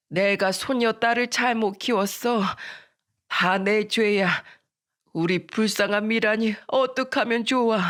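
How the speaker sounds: put-on old woman's sorrowful lament; lamenting grandmother. Grandmother blaming herself for granddaughter, sorrowful. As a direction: grieve as a self-blaming grandmother.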